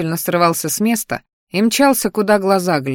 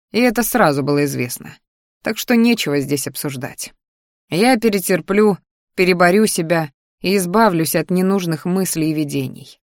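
ordinary delivery, nothing marked

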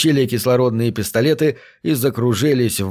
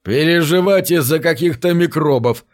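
higher, scoffing exclamation without real laughter; mock amusement, pitched up; scornful guffawing; 5 semitones higher than usual. A narrator's reading. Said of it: "хохотал Тим".

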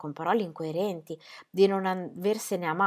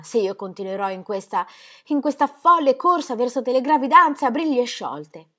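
voce femminile più acuta e agitata; Ingeborg implorante; 4 semitones higher, 7 dB louder than usual. Acted. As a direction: moglie che supplica con ansia. Ingeborg pleads with her husband. (voce alta e implorante di Ingeborg)

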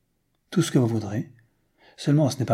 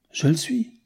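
raised pitch without real volume; a mildly indignant, higher cry; restrained cry; 4 semitones higher than usual. A narrator's reading. Newman protesting that he is noble, crying out. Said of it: "cria Newman".

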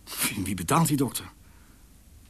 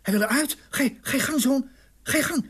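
urgent high urging; 12 semitones higher than usual. Acted. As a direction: push her through the birth. Urging wife to push.

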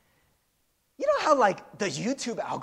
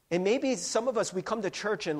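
ordinary talk, nothing marked

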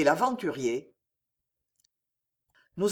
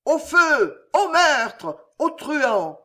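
high, loud alarm calls; crying out; 8 semitones higher, 6 dB louder, much slower than usual. A narrator's reading. Servants rushing to the innkeeper's rescue, shouting. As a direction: raise the alarm at full voice. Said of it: "criant et vociférant".

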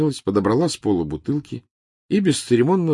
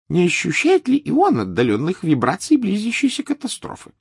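Trelawney voice; 6 dB louder than usual. Acted.

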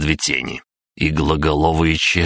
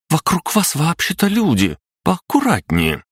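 высокий возмущённый вскрик девушки; резко, с обидой, выше обычного; возмущённо; 13 semitones higher than usual. Acted. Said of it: "и возмутилась". (возмущённый девичий голос)

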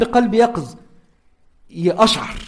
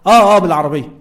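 louder, casual "آه، آه، بالعربية" as if on a call; answering the phone; 7 dB louder than usual. Shown as a demonstration. Teacher showing a phone reply, louder. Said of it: "يعني أنا ماسك تليفون".

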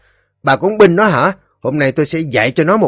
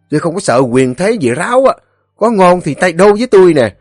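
high, loud, fast retort; angry husband; 8 semitones higher, 6 dB louder, faster than usual. A narrator's reading. Husband orders his meddling wife home, angry. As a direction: bluster and order her off, raised voice.

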